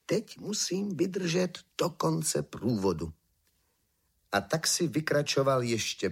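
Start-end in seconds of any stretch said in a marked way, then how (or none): 0.1-3.1 higher, slow, drawn-out musing; resolute inner thought. A narrator's reading. Emperor telling himself to last the procession, slow thought. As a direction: think it through slowly, pitched up. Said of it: "Ale myslel si".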